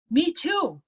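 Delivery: high, loud eager chime-in; eager agreement. Retold or imitated. Another veteran chimes in.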